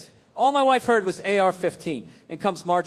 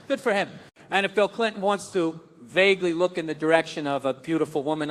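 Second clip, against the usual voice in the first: ordinary talk, nothing marked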